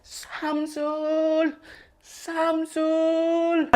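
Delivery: put-on far off calling the boy's name; distant calling. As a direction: call out across the village. Calling the lost boy. (memanggil dari jauh)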